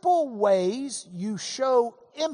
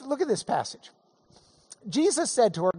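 ordinary talk, nothing marked

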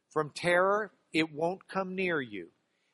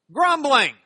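mock grumble; 8 semitones higher, 10 dB louder than usual. Mocking the grumbling Pharisees.

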